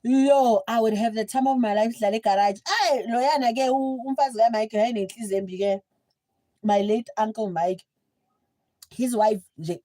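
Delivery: normal talking voice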